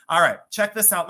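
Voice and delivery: loud call. Real, not acted. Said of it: "Why am I yelling?".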